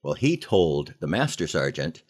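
ordinary delivery

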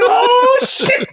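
high loud shocked yelp; startled scream. Retold or imitated. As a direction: yelp it in fright. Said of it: "I was like".

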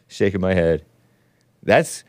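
ordinary talk, nothing marked

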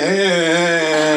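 loud, rapid embarrassed hellos; flustered greeting. Retold or imitated. Man caught in the bushes waves back, flustered and loud. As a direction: brush him off with hurried heys.

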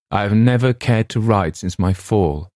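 usual speaking voice

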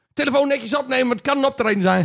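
stern father. Retold or imitated. Father's phone instruction retold.

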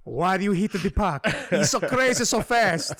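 hammed-up Italian rambling about hockey; Italian accent. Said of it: "I'm gonna just do it as Italian".

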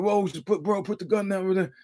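urgent, high-pitched "whoa, bro" from a scared crowd; panicky plea. Retold or imitated. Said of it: "everybody's like".

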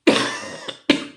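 single dry cough from a cold. Real, not acted. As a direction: clear the throat with a rough cough. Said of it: "Excuse me".

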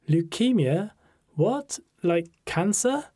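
high, rising questions of disbelief; shocked disbelief. A narrator's reading. Friend hears of the leukemia diagnosis, shocked. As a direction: react stunned, voice leaping up.

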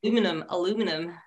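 aluminum said the American way, a-LOO-min-um, quick; mock American aluminum, a-LOO-min-um; American accent. British guest imitating the host's American aluminum. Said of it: "You said".

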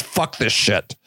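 deep deadpan Vader motto; Darth Vader t-shirt line. Retold or imitated. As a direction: drop it low like a slogan. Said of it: "It's just".

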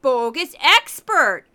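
loud, drawn-out Minnesota segment announcement; hammed-up Minnesota accent, big and loud; goofy Minnesota accent. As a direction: belt it out slowly in a silly Minnesota accent. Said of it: "that's where I do my goofy Minnesota accent".

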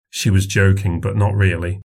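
usual speaking voice

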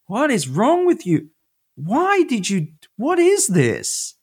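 high, incredulous God, slowing hard on the last question; picture a TV writer reading awful fan fiction; exasperated God. Retold or imitated. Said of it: "He's like".

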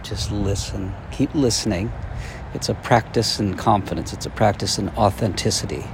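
soft, quiet guiding voice; guided exercise. Acted. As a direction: lead them gently into listening. Leads a listening exercise.

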